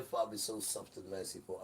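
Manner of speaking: ordinary delivery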